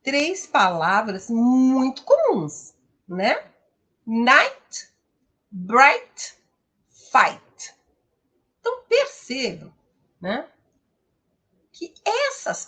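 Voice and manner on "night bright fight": "Night, bright, fight" each said loud as one syllable with ai; English model words. Shown as a demonstration. Teacher presenting English words to Brazilian students, loud and clear. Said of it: "têm uma única sílaba no inglês".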